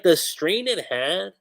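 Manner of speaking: ordinary talk, nothing marked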